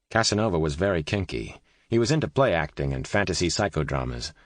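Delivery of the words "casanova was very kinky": plain reading voice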